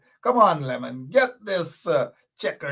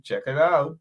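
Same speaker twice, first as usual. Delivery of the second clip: ordinary talk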